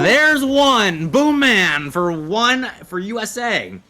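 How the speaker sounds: sudden high, excited shout of a goal; excited goal call. Real, not acted. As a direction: burst out calling the goal. Caster calls a goal.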